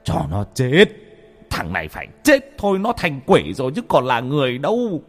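angry. A narrator's reading. Father answers wife angrily.

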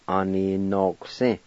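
"Aninoksi" said slowly and clearly; slow model word. Shown as a demonstration. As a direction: draw out "Aninoksi" for learners. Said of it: "pronounce the Q sound".

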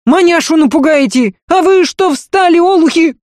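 high scolding yell; yelling housekeeper. A narrator's reading. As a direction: bellow at them to stop. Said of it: "с Дунькиным воплем". (вопль)

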